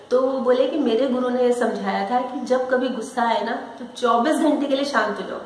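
ordinary delivery

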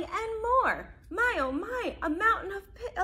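lively picture-book narration; reading to children. A narrator's reading. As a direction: read it out brightly to small kids. Reads picture book aloud.

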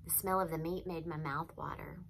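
usual speaking voice

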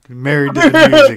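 high, loud joking declaration; mock proclamation. Bandmates turning down the lady asking about the drummer, high loud mock proclamation.

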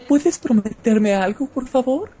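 high, slow falsetto plea; man doing a woman's voice. Acted. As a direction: plead slowly in a high lady voice. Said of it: "Dije de mujer, no de buque".

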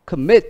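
commit with a high, loud MIT; stress on second syllable. Shown as a demonstration. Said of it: "Nó nhấn âm hai".

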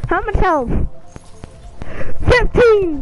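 high, loud disbelieving shout; outraged yell. Real, not acted. Outraged at enemy health.

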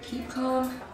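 soft, slow pep talk to yourself; hushed self-calming. Acted. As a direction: talk yourself down under your breath. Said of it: "just stay calm".

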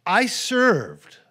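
loud, high, indignant complaint; the older brother protesting to his father, loud and resentful. Retold or imitated. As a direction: whine it like a wronged son.

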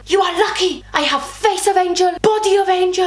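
high, boastful mock French accent; bad French accent. Boyfriend brags after being refused, bad French accent. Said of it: "doing a really bad French accent".

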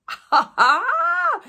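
high, loud burst of a laugh; reliving the shock of seeing the painting, high gleeful laugh; delighted laugh. Retold or imitated. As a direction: squeal a gleeful ha ha like a thrilled kid. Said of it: "I was like".

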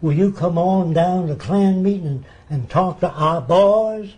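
imitated Southern accent, stretched out at the end; put-on Southern invitation, slowing on the last words. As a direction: do a Klan leader's Southern accent. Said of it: "he said in his southern accent".

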